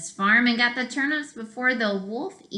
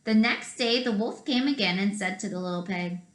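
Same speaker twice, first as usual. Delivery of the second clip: usual speaking voice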